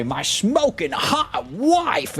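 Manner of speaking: very high, slow mock preacher gush; mock pastor. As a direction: ham up a pastor bragging about his wife. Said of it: "other pastors that'll say".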